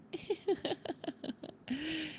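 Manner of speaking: high, quiet run of giggles; soft giggle. Real, not acted. Streamer amused by the Mishra nickname banter, soft high giggle.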